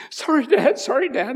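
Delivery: put-on high, loud, upset child's apology; high-pitched mock crying plea; imitated crying child. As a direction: mimic a daughter tearfully apologizing to her dad. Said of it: "she started crying".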